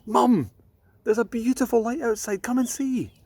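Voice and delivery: put-on a small girl calling Mum to come look; excited little girl. Ella calls her mother.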